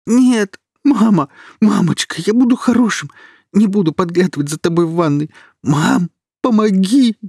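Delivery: put-on very high, loud child crying for mum; terrified little boy. A narrator's reading. Kirill crying for his mother like a little boy, terrified. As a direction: wail like a frightened child. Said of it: "превратился в маленького мальчика".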